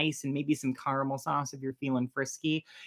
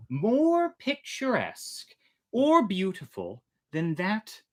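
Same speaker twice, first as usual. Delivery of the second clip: put-on slow, lifted reading voice for an old tale; measured storybook narration; story narration; 3 semitones higher than usual. A narrator's reading. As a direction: read it like a storyteller by lamplight. Reads the Mocha Dick text.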